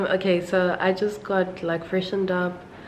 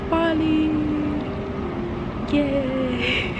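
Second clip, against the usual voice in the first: excited; 10 semitones higher, much slower than usual. Real, not acted. Excited about going to Bali.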